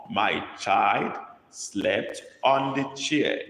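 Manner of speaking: put-on My child slept said word by word, very slow; slow dictation. Shown as a demonstration. Teacher dictating to the class, very slow and clear. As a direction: spell it out slowly for the class to copy. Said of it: "dictating a sentence to copy".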